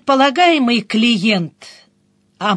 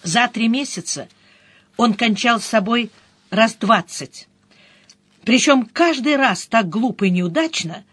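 plain reading voice

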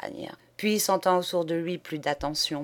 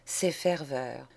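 plain reading voice

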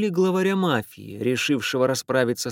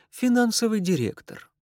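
put-on Irish accent; 8 semitones higher than usual. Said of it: "сильный ирландский акцент".

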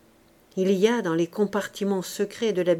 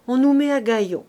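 ordinary delivery

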